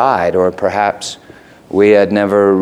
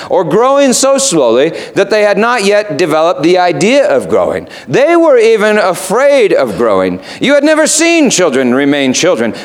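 the raven; 8 semitones higher than usual. Acted. Raven voiced in retold story.

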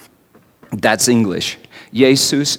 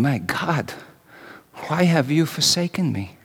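a scream held inside, said much softer; silent inner scream; 11 dB quieter than usual. Retold or imitated. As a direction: pour out anguish almost under the breath.

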